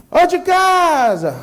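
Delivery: high, slow call into the dark; drawn-out high shout toward a house. Acted. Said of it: "Aí a pessoa está gritando".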